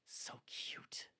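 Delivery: soft whispered aside. Real, not acted. Owner whispering to viewers about her cute dragon. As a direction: breathe it out, barely voiced.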